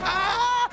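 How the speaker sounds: high, loud "ah"; high-pitched excited cry; excited scream. Acted. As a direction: let out a big loud yell. Excited outburst in acted scene.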